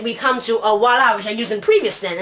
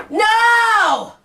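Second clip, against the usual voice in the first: no on an even higher note; 11 semitones higher, 3 dB louder than usual. Shown as a demonstration. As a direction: pitch the no way up. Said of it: "we can say the word no as".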